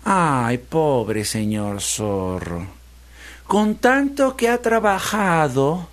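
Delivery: slow, drawn-out mock sympathy; pitying piglet. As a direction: coo over the poor sleeper, climbing high. Piglet pities the exhausted fox. (cerdito compasivo, lento y lastimero)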